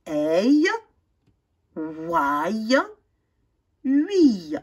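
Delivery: EI, OUAI, UI each drawn out alone; model sounds. Shown as a demonstration. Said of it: "Répétez".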